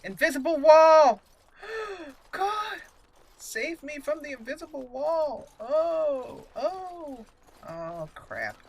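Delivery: ordinary talk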